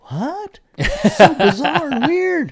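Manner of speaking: high, slow, incredulous; mock shock. Partner recalling the condom gift, high slow disbelief. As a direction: stretch it out in disbelief. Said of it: "And I was like".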